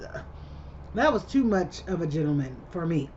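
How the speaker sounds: ordinary delivery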